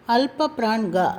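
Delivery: ordinary talk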